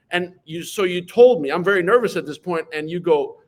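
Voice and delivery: ordinary talk, nothing marked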